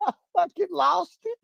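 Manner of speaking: high-pitched words squeezed through real laughter; helpless laughter while telling it; laughing hard. Real, not acted. Telling viewers about losing it in court, laughing hard. Cracking up at own story.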